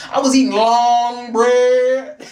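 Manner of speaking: loud, drawn-out comic punchline; hammed-up boast. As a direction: belt it out slowly, stretching the last words. Joking she became French.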